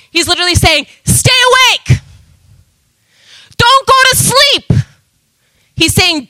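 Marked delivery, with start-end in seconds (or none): 1.0-4.7 much higher, urgent call to wake; paraphrasing God's urgent warning, high and urgent; urgent command. Acted. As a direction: plead with them to stay awake.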